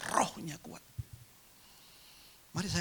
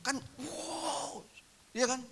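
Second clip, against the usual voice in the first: mock wow; 13 semitones higher, much slower than usual. Reacting to imagined windfall.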